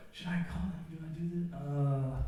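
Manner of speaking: second-guessing every move, worried; anxious inner voice. Retold or imitated. Nervous young man fretting over calling Jessica. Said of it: "I wasn't constantly like".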